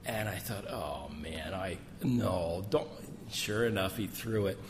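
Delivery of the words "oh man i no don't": dreading mutter. Retold or imitated. Dreading thought as bomb comes.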